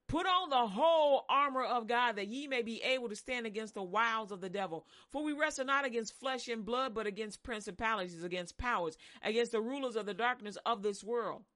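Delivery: loud, forceful scripture reading; scripture proclamation. Acted. Preacher proclaiming Ephesians to the congregation, loud. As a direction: proclaim it like armor going on.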